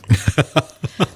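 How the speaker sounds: short nervous giggle; nervous laugh. Real, not acted. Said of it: "that's a nervous laugh".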